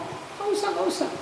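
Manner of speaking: very high and soft, pleading; a frightened little begging voice; mock whimper; whimpering plea. Corpse pleading.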